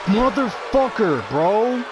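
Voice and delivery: high-pitched exasperated outburst; pissed off. Real, not acted. Gamer afraid the game will make him lose, pissed off. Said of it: "I'm getting fucking pissed".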